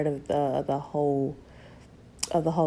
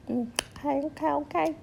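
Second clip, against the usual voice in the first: high hype-up; 9 semitones higher, 3 dB quieter than usual. Real, not acted. Hyping herself up in high voice.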